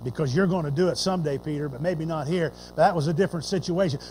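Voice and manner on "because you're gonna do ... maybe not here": low, soft, gentle soothing; Jesus calming Peter, low and quiet. Retold or imitated. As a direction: settle a hothead down gently. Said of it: "the Lord just kinda looked at him".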